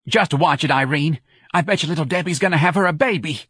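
high-pitched, eager exclamation; excited cry. Acted. Santa to her friend during the movie, excited. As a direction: call out a gleeful prediction. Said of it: "when Santa cried".

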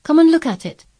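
high, loud, eager call; excited boy's invitation; exclaiming. A narrator's reading. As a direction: call the friend over excitedly. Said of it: "he exclaimed".

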